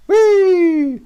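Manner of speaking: high gleeful whee; imitated whee. Dad voicing oldest son's jump, high gleeful whee. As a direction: squeal it as the son jumps. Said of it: "he goes".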